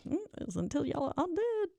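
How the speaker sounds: mock ominous answer in a high voice; sing-song. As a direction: tease it out in a high, soft lilt.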